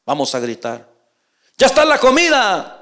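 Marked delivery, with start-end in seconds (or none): 1.6-2.7 aguda y fuerte, como llamando de lejos; llámalo a comer desde afuera. Acted. Familiar llama desde fuera. (llamada alta y fuerte)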